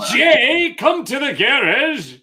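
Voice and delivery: high, hammed-up British accent; mother calling her son in a put-on British accent. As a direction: call Jay to the garage in a mock English voice. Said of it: "Or do I do a British accent".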